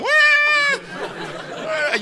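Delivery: put-on very high, puzzled child's question; confused little kid. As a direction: squeak it like a lost kid. Son can't see helicopter.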